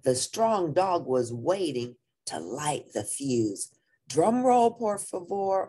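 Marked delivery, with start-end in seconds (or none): 4.1-5.7 announcer-style command, starting high; ordering. A narrator's reading. Don Diego before the cannon blast, ordering. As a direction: call for the drum roll. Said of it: "ordered Don Diego".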